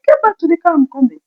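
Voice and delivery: high, loud hurried command; mother calling the kids back to the car; urgent order. Retold or imitated. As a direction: send the kids back quickly.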